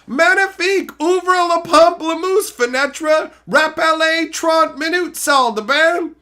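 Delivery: high, loud, hammed-up French phrases; mock French, high and exaggerated; french chef. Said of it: "I speak French too".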